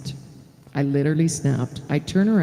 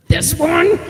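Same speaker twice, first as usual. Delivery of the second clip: high, loud disbelieving "this one?"; woman to the cop at her door, shouted disbelief; incredulous shout; 12 semitones higher, 6 dB louder than usual. Retold or imitated. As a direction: fire it back at the cop in disbelief. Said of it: "I was a little mad".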